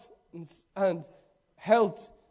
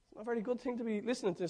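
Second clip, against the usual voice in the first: usual speaking voice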